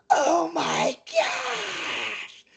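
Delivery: high, long drawn-out commentator scream; Joey Styles. Retold or imitated. Fan imitating a wrestling announcer's signature call, drawn-out high scream. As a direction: stretch it into a strained, wailing call. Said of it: "Joey Styles would say".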